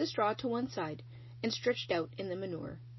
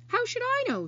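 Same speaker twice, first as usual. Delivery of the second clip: indignant rat; 6 dB louder than usual. Acted. Rat snaps back at Charlotte.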